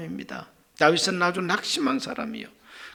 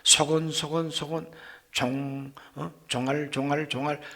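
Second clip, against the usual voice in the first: mock murmuring; 4 semitones lower, 7 dB quieter than usual. Shown as a demonstration. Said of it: "그런 소리로 하나님 앞에 말씀드린 게 아니에요".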